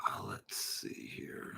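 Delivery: breathy whisper to himself, slow; faint slow murmur; whispered aside. Real, not acted. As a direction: whisper it under your breath while looking something up. Muttering to himself.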